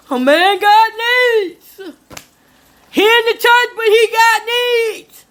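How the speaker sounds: mocking a smooth-talking man. She mimics the man's line for her audience, in a hammed-up, slow, high voice. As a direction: drag it out like a sleazy churchgoing guy. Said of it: "that same line that all these other guys say".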